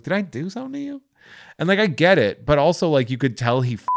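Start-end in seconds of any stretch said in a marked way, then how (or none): 0.0-1.0 high, fast mock-hurt question; incredulous question. Retelling asking the sulky waiter, high and fast with disbelief. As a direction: ask it in disbelief, pitched up. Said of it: "I was like".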